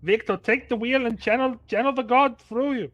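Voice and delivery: calling out over the deck; loud call. Acted. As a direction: shout it like a rallying cry. Shouting orders on the ship.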